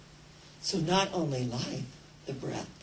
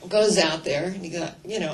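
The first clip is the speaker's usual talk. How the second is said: ordinary talk, nothing marked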